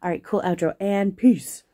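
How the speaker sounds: a hint of a slick YouTuber sign-off; lightly mocking cool outro voice; imitated cool outro. Imitating a friend's cool outro for the viewers. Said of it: "I think Quit might be like".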